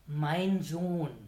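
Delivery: fatherly, friendly call; friendly paternal tone. A narrator's reading. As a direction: kindly address to a sinner. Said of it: "unter dem freundlichen Tonfall". (freundlich väterlicher Ton)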